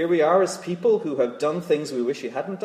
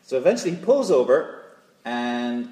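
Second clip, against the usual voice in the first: ordinary delivery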